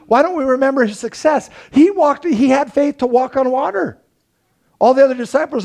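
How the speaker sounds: rising preacher's fervour; fired-up preaching. Acted. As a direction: drive the point home to the congregation. Preaching at full force.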